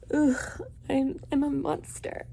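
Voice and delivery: mock brooding Edward. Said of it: "you're over here like".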